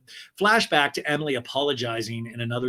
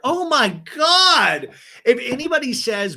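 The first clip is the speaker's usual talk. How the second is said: high, loud, drawn-out exclamation; appalled outburst; 11 semitones higher, 6 dB louder, slower than usual. Real, not acted. Host appalled at ladies calling him a sweetheart, loud outburst. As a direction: cry out in exaggerated disgust, stretching each word.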